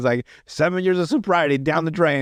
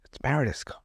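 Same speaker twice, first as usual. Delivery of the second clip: stunned, hushed realisation; suddenly quiet; 2 semitones lower, 9 dB quieter than usual. Retold or imitated. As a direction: drop to a much softer voice, haltingly. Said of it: "he gets quiet".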